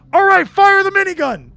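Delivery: very loud, high battle command; shouted order. Acted. Game master voices attackers as they get out, shouted order. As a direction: bellow the order to your crew.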